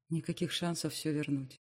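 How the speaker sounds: ordinary delivery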